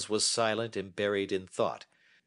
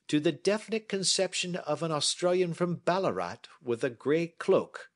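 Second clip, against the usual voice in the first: a touch higher, measured and assured; lightly voiced Holmes; 9 semitones higher, 4 dB louder than usual. Holmes inviting Watson to sit and listen, lightly voiced. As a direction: hold forth like a confident detective.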